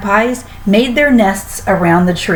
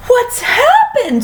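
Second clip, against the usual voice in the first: high, slow alarmed cry; a hammed-up high alarmed scream; slower than usual. A narrator's reading. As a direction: wail it high and slow in shock. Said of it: "screamed Missus Twit".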